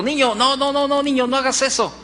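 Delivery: grito imitado. Retold or imitated. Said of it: "le gritó". (grito imitado, agudo y apremiante)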